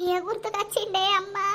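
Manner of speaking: tearful voice breaking into sobs; weeping softly at a painful memory; crying. Acted. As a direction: say it through tears, then sob. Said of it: "ఎందుకు ఏడుతాంది?".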